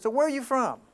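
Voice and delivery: high, loud call to the sailor; loud curious question. Retold or imitated. Asking the dream sailor where he's from, loud and high. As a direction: ask it loud and high across the deck.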